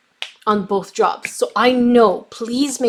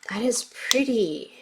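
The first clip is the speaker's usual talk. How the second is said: whispered; 7 dB quieter than usual. Real, not acted. Admiring paint colour.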